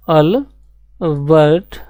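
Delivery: very drawn-out name, each syllable stretched; slow dictation. Shown as a demonstration. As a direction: spell the name out slowly for the class to write. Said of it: "कौन होंगे?".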